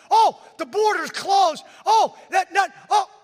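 mock panic, high and loud; panicky folks. Mocking the folks who panic at the closed border. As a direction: wail it like someone who gives up at once. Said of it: "For some folks".

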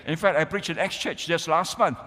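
normal talking voice

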